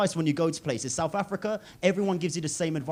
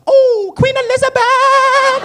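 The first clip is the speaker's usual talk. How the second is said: high, loud and drawn out Queen Elizabeth; excited cry; 12 dB louder, much slower than usual. Retold or imitated. Uber driver greeting an English passenger, slow and delighted. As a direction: cry it out slowly like the best news ever. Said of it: "He goes".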